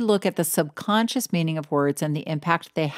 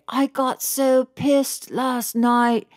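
quoting a British friend; 8 semitones higher, much slower than usual. Retold or imitated. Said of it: "I would have a friend who would say".

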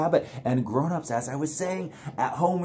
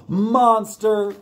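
excited call; 7 semitones higher, 6 dB louder than usual. Acted. Gasps at monster in book.

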